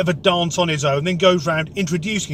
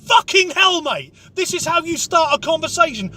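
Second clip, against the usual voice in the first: shocked outburst; 9 semitones higher than usual. Real, not acted. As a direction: blurt it out in shock. Reacting to casual racism.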